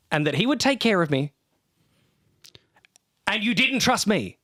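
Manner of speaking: high, forceful demand; angry demand. Acted. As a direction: push him to confess. [name]'s accusation rant.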